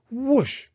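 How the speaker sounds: high, loud whoosh; a sweeping whoosh effect; whoosh sound. A narrator's reading. The notebook flies through the air, a loud whoosh.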